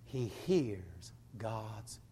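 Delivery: low, soft, nearly whispered preaching; hushed awe. Real, not acted. Hushes over God's small voice.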